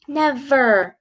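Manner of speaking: "Never" said clearly with a buzzed v in the middle; teacher's careful "Never", v sound brought out; middle V shown in Never. Shown as a demonstration. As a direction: show the V inside "Never". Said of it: "That's V in the middle of that word".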